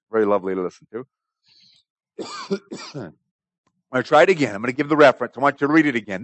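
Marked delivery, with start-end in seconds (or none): 2.2-2.9 short throat-clearing cough. Real, not acted. Preacher pausing his reading to cough, a quick cough. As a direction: cough briefly away from the talk. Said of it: "I'm gonna cough".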